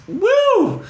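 high startled whoo from strong whiskey; sharp whoo. Retold or imitated. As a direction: yelp at a sharp taste. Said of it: "it is like".

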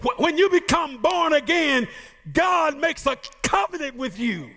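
rising preacher's cadence, calling out the promise; preaching. Acted. Preacher to congregation about the kingdom, fervent preaching. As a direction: preach it with full conviction to the church.

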